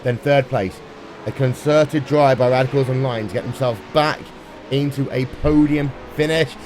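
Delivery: ordinary delivery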